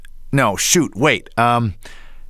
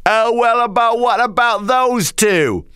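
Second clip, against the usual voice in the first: put-on drunk voice; 9 semitones higher than usual. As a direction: play a tipsy bar patron. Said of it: "The drunk goes".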